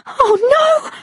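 very high, frightened cry; panicked. Acted. Woman as the metal box moves, panicked. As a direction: gasp it out in rising panic. Ledge giving way beneath her.

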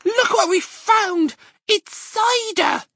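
high, slow, thrilled cub voice; excited cry. Acted. Little fox showing his dad the cider, high and excited. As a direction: shout the discovery, delighted. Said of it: "he cried out".

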